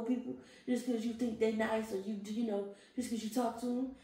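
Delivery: high lecturing scold; scolding friend. Retold or imitated. Friend scolding her for naivety.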